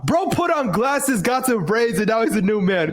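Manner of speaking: teasing in a high voice; high mocking voice. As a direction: pitch up to roast him. Roasting friend, high mocking voice.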